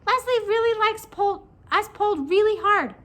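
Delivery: high, loud squeaky creature; loud high-pitched cartoon voice; little pigtail voice. A narrator's reading. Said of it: "said the pigtail".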